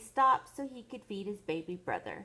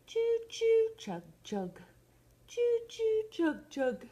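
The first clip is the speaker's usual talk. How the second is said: train noises; 4 dB louder, slower than usual. Acted. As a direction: play the train whistle, then the chug. Imitating a train in a story.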